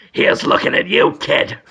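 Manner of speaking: a hint of a creepy whispery Dafoe; lightly voiced eye with a creepy lilt; Willem Dafoe. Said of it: "It's Willem Dafoe's voice".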